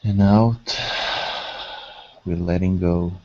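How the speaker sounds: measured, level delivery, leaving space to breathe; guided meditation. Acted. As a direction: guide them gently into calm, phrase by unhurried phrase.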